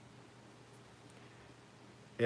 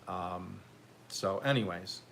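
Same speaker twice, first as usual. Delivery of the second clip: ordinary talk, nothing marked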